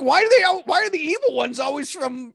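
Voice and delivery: mock-outraged high complaint about villain accents; exasperated disbelief. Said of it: "and I'm like".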